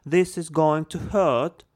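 slow, high posh British English; British accent. Shown as a demonstration. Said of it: "здесь надо будет с британским акцентом".

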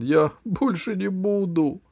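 high, whimpering promise; high frightened whimper; frightened plea. A narrator's reading. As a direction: beg like a scared child. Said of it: "повторял он".

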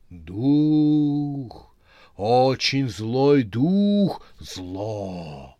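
slow, loud, frightening warning; seething shrieking spirit. Acted. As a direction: hiss it out with dread. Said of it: "зашипела она так страшно".

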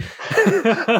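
short high burst of laughter; a quick amused laugh. Real, not acted. Laughs at the SLT slip.

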